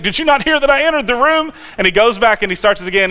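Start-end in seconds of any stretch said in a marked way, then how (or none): 0.0-1.5 mock-frustrated, higher complaint; a hammed-up miffed cartoon dad. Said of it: "He's like, frustrated".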